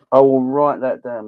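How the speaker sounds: emphatic promise. Real, not acted. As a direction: say it out, playful and sure. Repeats promise to write it down.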